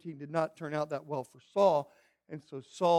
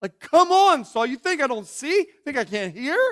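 high loud come on, scolding; exasperated rebuke; 8 semitones higher, 12 dB louder than usual. Pretend. Samuel scolding Saul for lying, high and loud. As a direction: call out the obvious lie.

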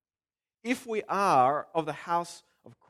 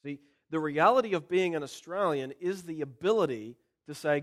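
ordinary delivery

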